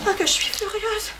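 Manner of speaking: ordinary talk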